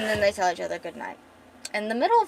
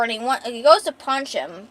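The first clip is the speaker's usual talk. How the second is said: usual speaking voice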